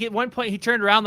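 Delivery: ordinary talk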